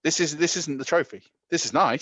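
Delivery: hint of a fired-up quarterback rallying a teammate; mock Brady pep talk. Continues Brady quote.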